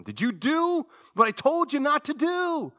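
God confronting Adam in the garden, booming. Acted. God's voice questioning Adam.

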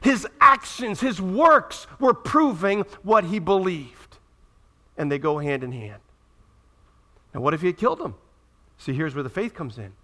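usual speaking voice

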